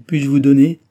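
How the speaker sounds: desolate cry. A narrator's reading. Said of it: "s'écria la désolée Cécile".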